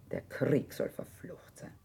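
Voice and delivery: slow, soft whispered curse; slow hushed bitter oath. Acted. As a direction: curse the war under your breath. Bitter curse after losing children.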